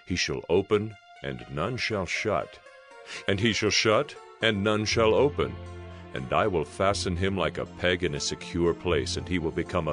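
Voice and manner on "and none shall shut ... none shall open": usual speaking voice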